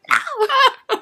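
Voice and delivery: high dismayed no; mock horror. Reacting to the teasing, high and dismayed. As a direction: squeal the no in dread. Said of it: "I'm like".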